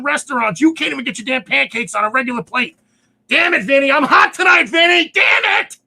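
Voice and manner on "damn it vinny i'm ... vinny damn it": loud, higher frustrated yelling; worked-up shout. Real, not acted. Ranting to Vinny on air, shouting in frustration. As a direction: blow off steam at full volume. Ranting about closed businesses.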